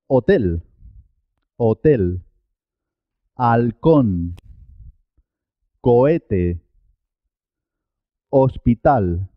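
"hotel, halcón, hospital" begun straight on the vowel; "cohete" as ko-EH-teh, "hotel" as o-TEL, a teacher's model; silent H. Shown as a demonstration. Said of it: "no suena, es muda".

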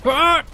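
high, loud yelp of fright; startled scream. Real, not acted. Player jolted by an attack, loud high scream. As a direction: shriek as it hits you.

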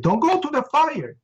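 high, frantic mother on the phone; shrill it out like a panicked mom; mother screaming down the phone at her son. Retold or imitated. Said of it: "she's screaming at me".

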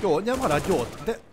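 urgent order. Real, not acted. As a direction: snap the order out.

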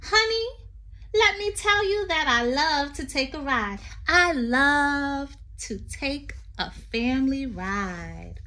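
sing-song read-aloud poem voice. Acted. Teacher reading a poem to children, lilting.

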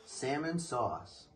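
plain reading voice

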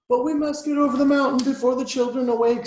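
high, loud insistence; urgent plea. A narrator's reading. Dolls urging the engine onward, urgent plea. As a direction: press the engine urgently to help.

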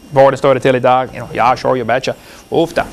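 a jokey hint of a Norwegian accent; mock Norwegian accent. Preacher teasing about Norwegians with a mock Norwegian accent.

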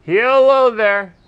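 long stretched hello call; slow drawn-out call. Real, not acted. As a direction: draw the call out slowly. Calling out at a house.

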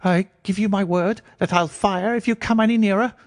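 very high reedy threat from a frightened man; reedy, quivering voice. A narrator's reading. Frightened patient threatening to fire at visitors, reedy and quivering. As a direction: threaten in a high, quavering pipe. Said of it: "came a reedy, quivering voice".

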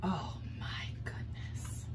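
stunned breathy whisper. Real, not acted. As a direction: breathe it in disbelief. Stunned after nonsense.

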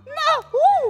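loud high panicked "no" shouts; real yelling. Real, not acted. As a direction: scream no as you lose the seat. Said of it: "estoy gritando un montón".

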